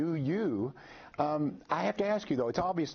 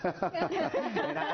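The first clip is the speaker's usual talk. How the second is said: laughing. Real, not acted.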